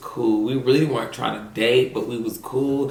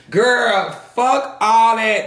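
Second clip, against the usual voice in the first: higher, louder, slow and scornful; emphatic dismissal; 9 semitones higher, 5 dB louder, much slower than usual. Real, not acted. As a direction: wave it off hard, drawn out.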